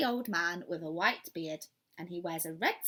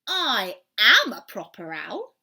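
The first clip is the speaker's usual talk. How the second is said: much louder, slow indignant protest; cross; 3 semitones higher, 13 dB louder than usual. Acted. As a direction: stamp it out crossly. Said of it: "getting very cross".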